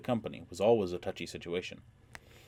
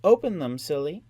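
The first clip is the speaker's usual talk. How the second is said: teasing; 8 semitones higher, 9 dB louder than usual. Acted. Teasing reply.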